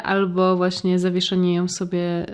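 usual speaking voice

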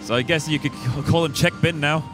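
normal talking voice

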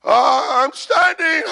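high, strained, hammed-up wobble; mock struggling to stand. Shown as a demonstration. Preacher acting how you may feel, mock struggling. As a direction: act about to topple, ending in a broken laugh-like cry. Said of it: "Although you may feel like that".

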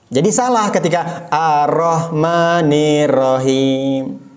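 usual speaking voice

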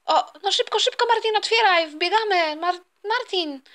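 high-pitched, panicky hurrying; frantic urging. Acted. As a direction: urge him on in a high voice. Mother urges husband to open door.

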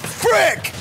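sudden high yell; frustrated shout. Real, not acted. Sudden frustrated outburst in game.

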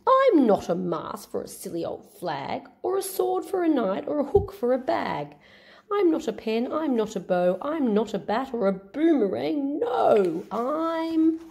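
high, sing-song little stick hero; Stick Man voice. Acted. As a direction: chant the refrain brightly, stretched out. Stick Man's protest.

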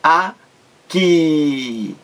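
"aqui" split as "a", pause, long "qui"; syllable by syllable. Shown as a demonstration. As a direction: break "aqui" in two, holding the "qui". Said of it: "A segunda, que". ("aqui" separado em sílabas, com "qui" alongado)

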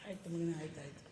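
hushed parental reassurance; soothing. Real, not acted. Consoling a crying child.

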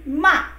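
Ma falling sharply; fourth tone ma. Shown as a demonstration. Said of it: "And the fourth tone".

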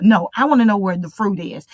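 ordinary talk

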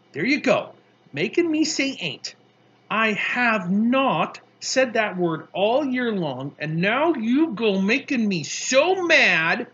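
fed up and building in outrage; indignant scolding. A narrator's reading. Girl scolds boy, says mad.

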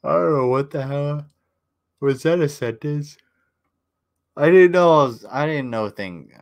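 high, loud self-mocking confusion, easing at the end; mock bewilderment. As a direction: exclaim as if baffled by your own words. Mocks own garbled sentence.

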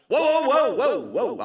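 high first burst, then tumbling whoas; panicked. Retold or imitated. Mistaken celebrity stopping the fan, mildly panicked. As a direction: cut in with retold panic. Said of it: "I panicked".

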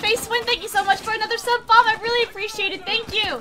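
high, gushing thank-you; thrilled, high-pitched thanks; excited gratitude. Real, not acted. Thanking viewer for gifted subs.

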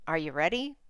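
ordinary delivery

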